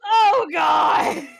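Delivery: loud, high wail; horrified cry. Real, not acted. Player reacting to the picture of gallows, horrified cry. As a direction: wail it out in dismay.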